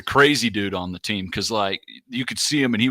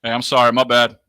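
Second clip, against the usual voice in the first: louder, hammed-up sheepish apology; apologetic player; 6 dB louder than usual. Hyped player apologizing to teammates, mock-sheepish and loud. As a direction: say sorry like a player who got carried away. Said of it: "The sound effects are".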